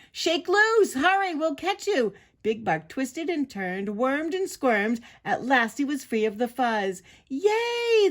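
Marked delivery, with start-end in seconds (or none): 0.1-2.1 high, urgent shouts; frantic, high encouragement; shouting. A narrator's reading. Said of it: "The prairie dogs shouted". 7.3-8.1 very high, loud whoop; long squeal of joy; cheering. A narrator's reading. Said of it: "the crowd cheered".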